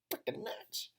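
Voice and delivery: whispered aside; hushed mock disbelief. Real, not acted. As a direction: breathe it under your breath. Mocking the protesters.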